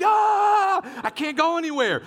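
very high, loud hammed-up wail; mock panicked scream. Preacher acting out being dive bombed by wasps, mock scream. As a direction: scream it out like you're being swarmed. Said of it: "and I am screaming".

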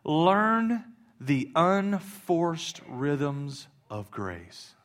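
very slow, drawn out, fading; slow savoring read. Real, not acted. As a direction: linger on every word. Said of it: "I love this, this line right here".

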